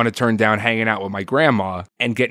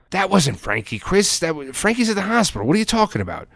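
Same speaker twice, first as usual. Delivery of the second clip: high, disbelieving insistence; insistent; 9 semitones higher than usual. Retold or imitated. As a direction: push back, he's wrong. Father arguing back.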